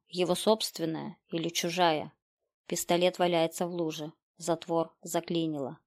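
ordinary delivery

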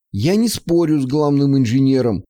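usual speaking voice

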